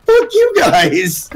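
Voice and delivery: high, slow mock yell; retold angry retort. Driver at red light to punk kids, mock angry retort. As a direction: drag it out high, mock indignant.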